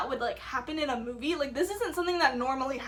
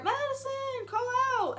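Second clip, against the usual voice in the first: high, far-carrying call; teacher calling out to a lost student, high; 11 semitones higher than usual. Retold or imitated. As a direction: call her name across the woods. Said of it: "I hear".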